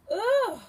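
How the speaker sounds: loud high ugh; disgusted groan. Acted. Ringer disgusted.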